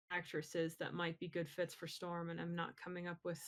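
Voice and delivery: ordinary delivery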